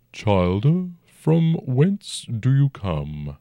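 slow, rumbling ancient dragon. A narrator's reading. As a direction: speak as a huge old dragon, unhurried. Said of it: "the dragon said".

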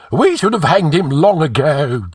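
high, loud agitated gibber. A narrator's reading. Frightened High Counselor about the treacherous poet, gibbering. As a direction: gibber it high-pitched and fretful. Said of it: "gibbered Publius".

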